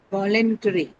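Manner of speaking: "Voluntary" with the weight on VOL; stress on first syllable. Shown as a demonstration. Teacher quizzing class on stress, "Voluntary" stressed on VOL. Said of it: "the first syllable".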